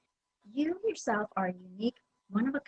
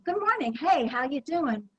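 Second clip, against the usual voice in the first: higher-pitched, welcoming customer greeting; happy greeting; 5 semitones higher, 5 dB louder than usual. Shown as a demonstration. Trainer shows staff a good customer greeting, happy. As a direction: greet the customer as if glad to hear them. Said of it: "Are you happy to be".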